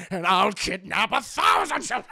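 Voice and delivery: sudden loud shout; imitating the old boss flipping into rage; villain snapping. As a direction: explode into the evil confession. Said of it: "he loses his fucking mind".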